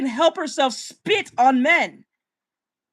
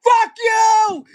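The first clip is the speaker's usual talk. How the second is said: furious shout; 10 semitones higher, 8 dB louder, much slower than usual. Acted. Outraged at One Piece opinion.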